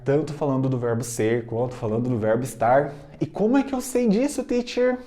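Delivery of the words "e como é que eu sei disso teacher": high questioning student voice; student asking. Retold or imitated. Teacher voicing a student's high puzzled question.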